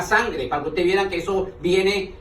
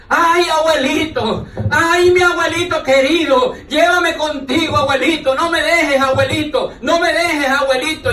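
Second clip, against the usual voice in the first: hammed-up wailing granddaughter; 8 semitones higher, 4 dB louder than usual. Imitating granddaughter wailing at burial.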